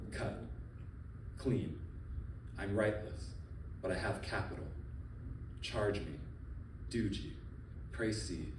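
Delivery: ordinary delivery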